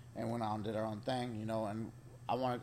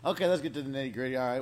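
excited; 3 semitones higher, 8 dB louder, faster than usual. Real, not acted. Launching into the juicy story.

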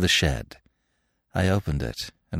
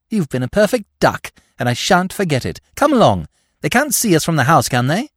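put-on lively girl, higher and a bit louder; bright and impatient, urging him to hurry; eager young girl; 8 semitones higher, 4 dB louder than usual. A narrator's reading. Girl urges the narrator toward the shed, eagerly.

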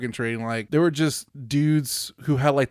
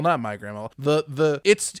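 ordinary delivery

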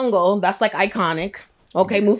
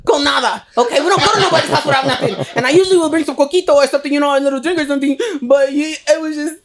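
laughing; 6 semitones higher, 5 dB louder than usual. Real, not acted. Laughing rant about bringing gifts.

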